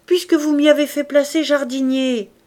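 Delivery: emphatic good-natured reminder; insistent old gardener. A narrator's reading. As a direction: protest that it is obvious. Old man insists on the obvious.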